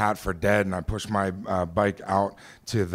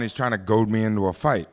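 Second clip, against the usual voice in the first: ordinary delivery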